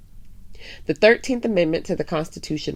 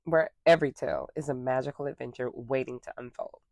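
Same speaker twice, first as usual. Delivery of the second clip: story time host; 5 dB quieter than usual. Acted. Host welcoming young listeners to story time, cheerful greeting voice. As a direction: open the show like a cheerful children's story host.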